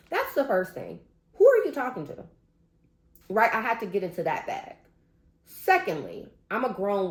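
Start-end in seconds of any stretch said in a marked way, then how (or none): 1.3-2.2 indignant challenge. Retold or imitated. Confronting condescending coworker.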